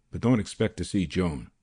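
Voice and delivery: plain reading voice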